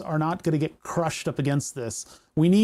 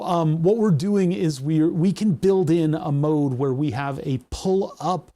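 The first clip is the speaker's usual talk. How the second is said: normal talking voice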